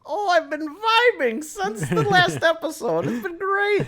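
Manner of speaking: put-on high, loud creaky old man. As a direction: play the doddering old witness. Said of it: "trying to do this old man voice".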